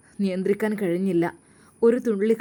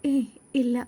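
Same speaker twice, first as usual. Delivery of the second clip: with a small smile; 5 semitones higher than usual. A narrator's reading. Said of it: "ചെറിയൊരു പുഞ്ചിരിയോടെ പറഞ്ഞു".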